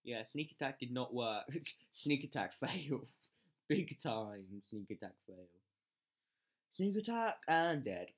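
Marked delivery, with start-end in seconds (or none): 1.9-5.5 slow words through laughter; giggling self-mockery; laughing. Real, not acted. Laughing at own failure. 6.7-8.1 mock announcement. Real, not acted. Announcing the failure.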